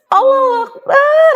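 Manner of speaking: high, desperate scream of Allahu Akbar; frightened woman screaming a prayer; tearful shout. Retold or imitated. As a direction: cry out to God in terror. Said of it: "aku teriak kenceng banget sambil nangis".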